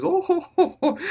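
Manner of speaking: high, haughty oh-ho-ho cackle; Frieza's mocking laugh. Reviewer mimics villain laughing at the transformation, high cackle. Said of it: "he just starts laughing".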